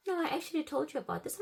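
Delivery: high, quick mock protest; imitated gaslighting insistence. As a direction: mimic him insisting she is wrong. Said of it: "made it seem like I'm the crazy one".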